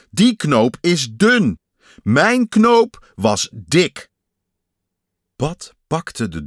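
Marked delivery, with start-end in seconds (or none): 0.1-4.1 loud, slow, high protest; exasperated. A narrator's reading. Pat explains which button is his, loudly exasperated. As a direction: hammer out each word slowly and loudly.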